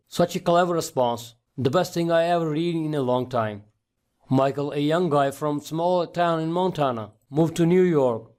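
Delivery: usual speaking voice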